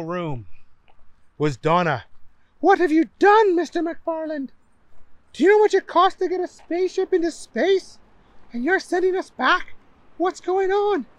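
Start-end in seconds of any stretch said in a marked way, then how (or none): 2.6-11.1 high upset woman voice. Acted. Donna scolds Booger.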